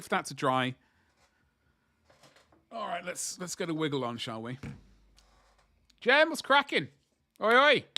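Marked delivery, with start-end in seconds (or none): 6.0-7.8 loud, high call-out to Jem; shouting greeting. Real, not acted. Streamer shouting hello to chat member Jem, loud and high. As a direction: yell a cheery hello. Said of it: "Don't know why I'm shouting at you".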